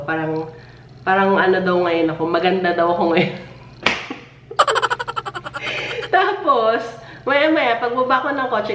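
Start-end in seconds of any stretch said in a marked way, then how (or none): 4.5-6.0 a high falling giggle; shy high laugh; high embarrassed laugh. Real, not acted. After being called pretty. 6.1-8.7 pitched up after laughing; amused, higher voice; higher, still amused. Real, not acted.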